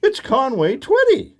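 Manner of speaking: high, excited gasp of a star-struck fan; starstruck young lady. Retold or imitated. Young lady at the door squeals at the pastor. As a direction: squeal it like she's met her idol. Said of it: "and she says".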